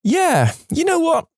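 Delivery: comic high voice. Acted. Narrator echoing the aside in a squeaky high voice. Same aside repeated in odd voice.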